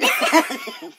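squeaky laughing voice; high squeal of laughter. Real, not acted. Cracking up mid-sentence.

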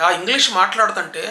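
ordinary talk, nothing marked

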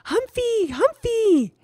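put-on high, excited toddler shout; shouting little child. A narrator's reading. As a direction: shout it like a tiny boy running in.

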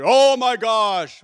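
booming high-pitched exclamation; mock-thunderous, drawn-out outcry; very loud deacon. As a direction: bellow in alarm, stretching every word. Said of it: "he was very loud".

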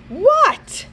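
sudden loud high shout; child's loud shouted what. A narrator's reading. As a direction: snap out a startled shout. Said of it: "shouted Sadiq".

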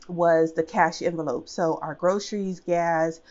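normal talking voice

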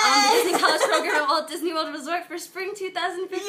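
high, loud, laughing shout; real overjoyed yelling; excited shout. Real, not acted. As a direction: burst out with joy while reading. Reading acceptance letter aloud.

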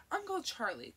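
deep scolding voice; chiding. A narrator's reading. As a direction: scold him, dropping low on the name. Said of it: "voice chiding, deep, dipping deeper with his name".

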